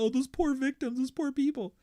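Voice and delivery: high, whiny mock weeping; mock a soldier tearing up over victims; hammed-up tearful whine; mock crying. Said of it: "Nobody fucking cries as".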